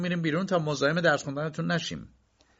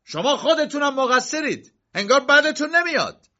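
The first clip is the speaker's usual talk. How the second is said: furious yell, much higher and louder; angry shout; 6 dB louder than usual. A narrator's reading. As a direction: shout your blame at her. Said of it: "کی با عصبانیت داد زد". (فریاد خشمگین با صدای بسیار بالا و بلند)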